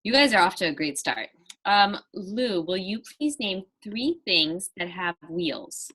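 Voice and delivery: normal talking voice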